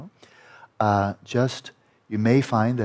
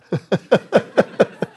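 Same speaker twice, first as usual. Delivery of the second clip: laugh; 9 semitones higher, 6 dB louder than usual. Real, not acted.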